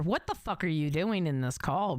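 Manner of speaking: ordinary delivery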